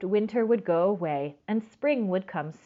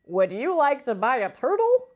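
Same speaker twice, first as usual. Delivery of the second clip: pet man; 5 semitones higher, 5 dB louder than usual. Acted. Pet man voiced in story.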